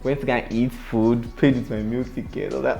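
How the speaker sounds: normal talking voice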